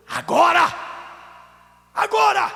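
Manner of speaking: sudden high, full-force shout; high, loud exorcist's cry; shouted command. Retold or imitated. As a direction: fire it out as an explosive order. Climax of retold rebuke.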